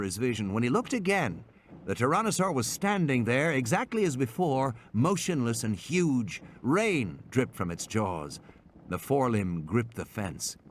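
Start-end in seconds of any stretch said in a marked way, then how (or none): none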